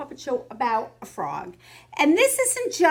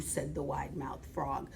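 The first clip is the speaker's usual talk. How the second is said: normal talking voice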